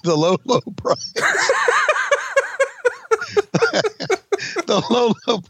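giggling through the words; laughing while repeating the line. Real, not acted. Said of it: "I love that".

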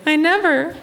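high-pitched imitation of mom; indignant mom. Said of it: "And she's like".

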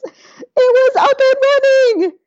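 high, slow, drawn-out cry of triumph; triumphant. Real, not acted. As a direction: stretch "up and running" out high and loud in pure delight. Triumph after fixing the server.

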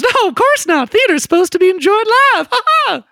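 very high-pitched, eager newsboy voice; excited paper boy. Acted. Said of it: "he goes".

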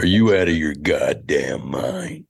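low, slow drawl; Jack Nicholson. Acted. Comedian showing host his impressions, low Nicholson drawl. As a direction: drawl it low like the movie star. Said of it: "I do Nicholson".

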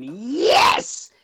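high, drawn-out mock cheer; listeners cheering. Voicing relieved listeners, exaggerated excited cheer. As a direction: swoop up into a gleeful yes. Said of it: "all of the listeners right now are going".

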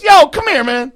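loud, high mock holler; retold calling out. Keith Williams calling over a near stranger, loud holler. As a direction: imitate someone hollering a person over.

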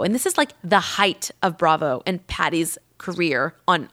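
ordinary talk, nothing marked

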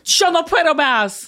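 eager learner's Spanish echo; attempted Spanish accent. Shown as a demonstration. Echoing co-host's prompt, attempted Spanish accent. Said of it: "nailing this accent".

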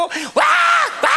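high, open-throated yell; mock full-throated "waah"; retold prayer shout. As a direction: imitate someone roaring into an ear. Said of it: "하고 소리 질러".

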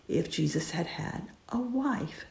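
breathy hushed aside, almost secret; hushed whisper. Real, not acted. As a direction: drop to a whisper to land the point. Hushed point about Jesus's wife.

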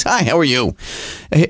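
normal talking voice

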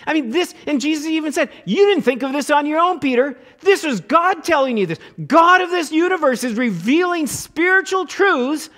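high, loud, rising preacher's fervour; fired-up pulpit delivery; fervent preaching. Acted. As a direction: build the excitement toward the peak. Preacher builds to fervent peak.

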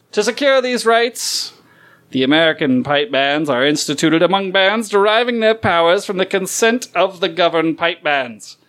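grand, declaiming reading; formal proclamation. Acted. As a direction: read it like a founding document. Reads mock Declaration of Independence.

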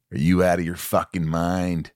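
a hint of menacing Jack; Nicholson. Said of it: "And he goes".

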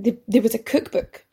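usual speaking voice

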